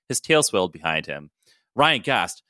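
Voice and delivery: ordinary delivery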